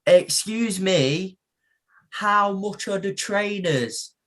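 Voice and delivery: high, slow polite voice of a shopper; a woman in Turkey mistaking him for the shop lad; imitating a woman shopper. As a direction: ask sweetly, drawing each word out. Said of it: "with this box and goes".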